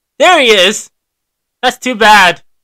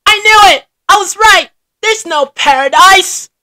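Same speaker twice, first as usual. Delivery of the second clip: high, loud I-told-you-so cry; triumphant outburst; 10 semitones higher, 6 dB louder than usual. Acted. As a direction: shout it, vindicated.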